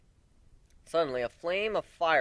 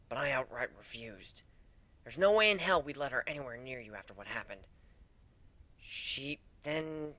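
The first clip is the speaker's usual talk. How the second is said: usual speaking voice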